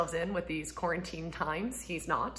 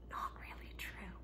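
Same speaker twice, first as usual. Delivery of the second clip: whispered under the breath; 17 dB quieter than usual. Real, not acted. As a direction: drop to a quick voiceless aside. Aside on marriage myth.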